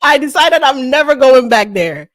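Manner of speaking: high, loud, slow haughty diva brag; mock diva. Guest bragging to host about first class, hammed-up diva voice. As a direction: play the spoiled star who won't fly coach. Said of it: "You're too diva-like for me".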